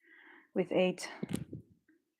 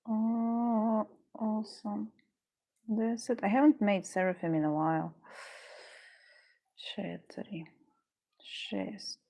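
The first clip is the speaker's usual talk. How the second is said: muttering under breath; 7 dB quieter, slower than usual. Real, not acted. Counting beads under her breath.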